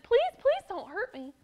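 put-on frightened boy begging armed men; pleading in fear. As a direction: beg for mercy. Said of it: "in fear and said".

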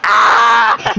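loud imitated scream of panicking kids; mock kid's scream. Showing friends the kids' breakdown, mock scream. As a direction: scream like a child in meltdown. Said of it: "kids are having a fucking breakdown".

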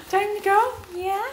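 squeaky happy pet voice; high excited dog talk. Real, not acted. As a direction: hype the dog up.

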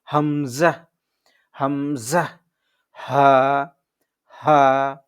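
হামজা and হা said hard from the throat's start; firm glottal হা, loud; firm hamza. Shown as a demonstration. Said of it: "এটা হচ্ছে শক্ত করে". (শক্ত করে হামজা)

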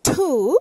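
two said as tu with a puff of air; aspirated T. Shown as a demonstration. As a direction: breathe out on tu. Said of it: "que se dice".

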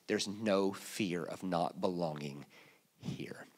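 hushed, deliberate assurance; soft slow emphasis. Real, not acted. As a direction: lean in and say it quietly, slowly.

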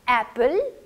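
एप्पल loud, open short "a", rising at the end; model pronunciation. Shown as a demonstration. Teacher drilling the A sound, loud model pronunciation. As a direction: punch the open "a" starting एप्पल. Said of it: "mouth को open करके".

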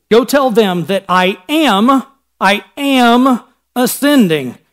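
slow, deliberate weight on "am"; stress on AM. Shown as a demonstration. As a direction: draw out "I am", pausing before "ascending". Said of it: "That's a present tense".

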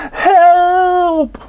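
high, loud cry for help; scream for help. A narrator's reading. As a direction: shout it while falling. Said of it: "I screamed".

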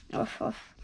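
breathy little woof, no voice; whispered puppy woof. Acted. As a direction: whisper the bark like a tiny pup. Puppy bark in story.

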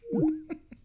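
short high-pitched giggle; high laugh. Real, not acted. Prankster cracking up after hanging up, high laugh.

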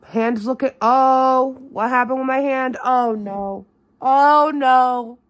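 loud alarmed oh no; shocked. Real, not acted. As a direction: react to a nasty surprise. Shocked at her hand.

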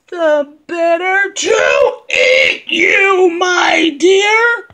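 loud, slow, shouted wolf; big bad wolf roar. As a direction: roar it out to pounce. Wolf reveals itself.

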